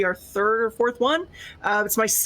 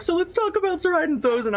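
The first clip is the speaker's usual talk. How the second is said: imitating Eric; 8 semitones higher than usual. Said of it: "Eric will be like".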